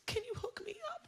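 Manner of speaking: high, soft mocking mom voice; mom's request. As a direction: squeak it out like a sly mom. Mom asks for weed, music.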